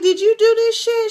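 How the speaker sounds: high-pitched accusing question; mock accusation. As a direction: imitate them demanding an answer.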